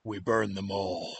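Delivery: low, slow guttural growl. Acted. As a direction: growl it from deep in the throat. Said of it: "a guttural voice comes out of your mouth".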